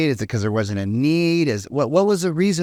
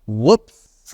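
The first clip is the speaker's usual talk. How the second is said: mock whoops; 2 semitones lower, 5 dB louder than usual. Comic reaction to one-night stand.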